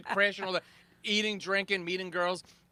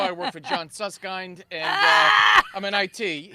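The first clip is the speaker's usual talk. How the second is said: flustered; 8 dB louder, slower than usual. Retold or imitated. Caught crashing, flustered.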